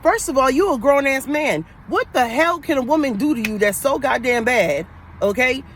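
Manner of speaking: usual speaking voice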